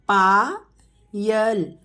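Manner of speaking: Payal stretched very slowly; slow teacher model of Payal. Shown as a demonstration. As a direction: draw Payal out sound by sound. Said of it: "teacher models name slowly".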